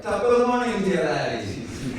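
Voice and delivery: loud, high Irish pilot's greeting; jolly put-on Irish accent, starting high and loud; mock Irish accent. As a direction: call out in a hammed-up Irish brogue. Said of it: "there's just some accents that don't fit".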